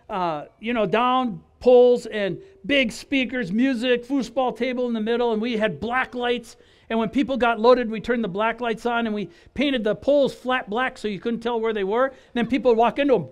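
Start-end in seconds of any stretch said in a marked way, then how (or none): none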